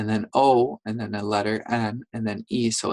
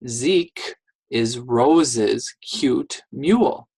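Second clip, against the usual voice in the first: slow careful reading; much slower than usual. Shown as a demonstration. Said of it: "Now let's do the next sentence".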